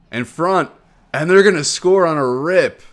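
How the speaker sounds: high, very loud goal shout; excited goal call. Real, not acted. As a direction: erupt as the shot scores.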